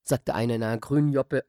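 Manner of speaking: plain reading voice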